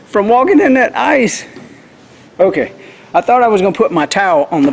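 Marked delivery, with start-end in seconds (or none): 0.1-1.4 struggling to talk from cold; too cold to talk. Real, not acted. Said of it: "can't even talk".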